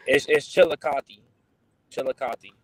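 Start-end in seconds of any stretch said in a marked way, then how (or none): 0.5-1.2 Chillicothe as "chill-uh-KAH-thee"; local pronunciation. Shown as a demonstration. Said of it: "how do you pronounce it?". 1.9-2.5 Chillicothe repeated as "chill-uh-KAH-thee"; local pronunciation. Shown as a demonstration. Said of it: "how do you pronounce it?".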